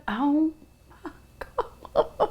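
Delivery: breathless laugh through the words; laughing helplessly. Real, not acted. Bursts out laughing over pie.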